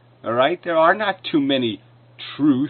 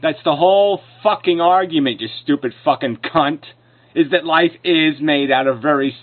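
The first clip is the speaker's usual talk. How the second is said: raised, forceful contradiction; real angry retort; 2 semitones higher, 3 dB louder than usual. Real, not acted. Debater insulting the man who contradicted him, angry. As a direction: hit back angrily at an opponent. Heated argument, swearing at opponent.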